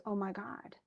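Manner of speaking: very soft, acted realization; hushed dismay. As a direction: let it drop to a stunned undertone. Oedipus realizes he killed Laius.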